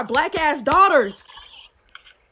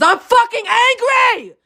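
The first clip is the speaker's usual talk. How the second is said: loud, slow, furious yell; angry shout; 6 semitones higher, 5 dB louder, slower than usual. Real, not acted. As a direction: let the anger explode, drawn out.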